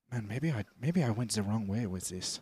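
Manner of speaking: put-on low, quiet German-accented second thoughts; subdued caricatured German leader; mock Hitler. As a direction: mutter a doubt in a fake German accent. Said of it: "He's like".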